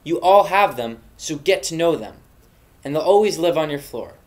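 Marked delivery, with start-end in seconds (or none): none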